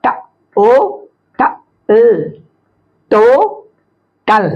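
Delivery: ट, ओ, ट, ल singly, then तो, टल; sounding out. Shown as a demonstration. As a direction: spell by sounds, then two syllables तो-टल. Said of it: "उसी तरह से".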